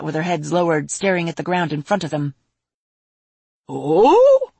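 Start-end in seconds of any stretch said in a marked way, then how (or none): 3.7-4.5 loud, high rising dragon voice; intrigued. A narrator's reading. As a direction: draw it upward in grand, curious surprise. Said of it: "The Patriarch raised an eyebrow".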